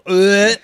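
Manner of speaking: loud mock groan of disgust; disgusted ugh. Host groaning in disgust at the story. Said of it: "he just goes".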